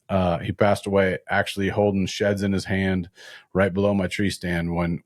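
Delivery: normal talking voice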